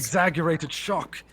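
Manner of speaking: high, hammed-up mock shock; exaggerated shock. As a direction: overact the astonishment. Mocks player's overacting, words name manner.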